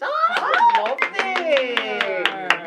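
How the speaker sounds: delighted. Acted. Bottom admiring his own rant.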